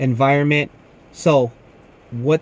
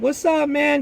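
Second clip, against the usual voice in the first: high, bright friendly hello; cheerful greeting; 12 semitones higher than usual. Retold or imitated. Customer greets him as friend.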